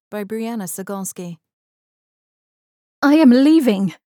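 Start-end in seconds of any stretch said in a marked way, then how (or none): 3.0-4.0 loud, high, firm declaration. Acted. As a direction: plant your feet and say it. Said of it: "she said firmly".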